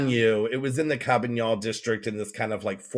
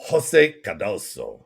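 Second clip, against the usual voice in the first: louder, deliberate Spanish accent, slowing on the name; 4 dB louder than usual. Shown as a demonstration. Repeating the book title for her co-host, Spanish accent. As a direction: give it a showy Spanish accent. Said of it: "say it with my Spanish accent".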